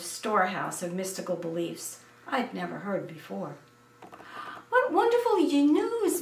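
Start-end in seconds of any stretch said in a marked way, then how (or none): 4.7-6.2 high, bright-eyed delight; mother exclaiming happily at the news; excited exclaim. A narrator's reading. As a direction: burst out with joy like a proud mother. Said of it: "my mother exclaimed".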